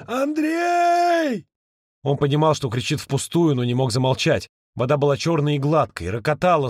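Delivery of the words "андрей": high, long desperate yell; yelling into darkness. A narrator's reading. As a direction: scream the name into the dark. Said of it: "снова завопил в темноту". (вопль в темноту)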